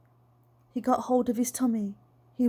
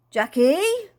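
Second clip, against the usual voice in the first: louder, high, drawn-out call; high rising holler from outside; insistent calling; 6 semitones higher, 6 dB louder than usual. A narrator's reading. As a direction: sing the name out with a long upward sweep.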